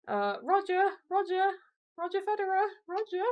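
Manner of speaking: high-pitched mock calling out; Martin Brundle imitation. Commentator calling to Roger Federer for an interview, high mock voice. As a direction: put on a high voice, calling for attention. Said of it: "Martin Brundle's like".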